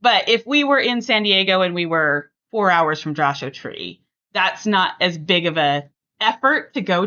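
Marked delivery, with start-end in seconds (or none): none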